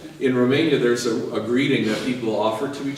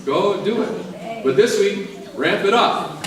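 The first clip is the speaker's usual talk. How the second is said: higher, louder, urging them on; rousing urging; 10 semitones higher, 6 dB louder, slower than usual. Real, not acted. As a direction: fire up the congregation. Pushing the congregation on.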